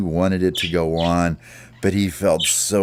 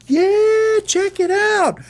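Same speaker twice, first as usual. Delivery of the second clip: very high, loud squeaky cheer; the gang cheering Ollie on, in a very high, loud, squeaky voice; cheering bird voices; 5 dB louder, slower than usual. Acted. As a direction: whoop like thrilled little birds. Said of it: "They were like".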